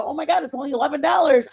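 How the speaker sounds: re-enacted excited discovery. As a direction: blurt it out in a thrilled rush. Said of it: "and I got excited".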